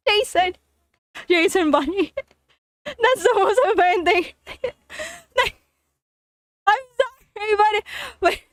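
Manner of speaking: helpless high-pitched laughing fit; crying with laughter. Real, not acted. Reader cracking up over crybaby Jason, crying with laughter. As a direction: push words out between bursts of laughter. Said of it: "Now I'm crying like Jason".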